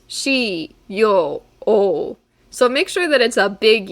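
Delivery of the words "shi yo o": "Shi, yo, o" said as three separate syllables with a short break between each. Shown as a demonstration. Teacher breaks shiyou into syllables for students, one clear syllable at a time. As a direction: split "Shi, yo, o" into three distinct beats, never run together as sho. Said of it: "So this is three syllables".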